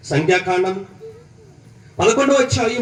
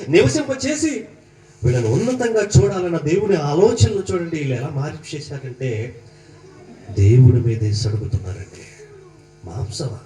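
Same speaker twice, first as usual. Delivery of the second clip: ordinary delivery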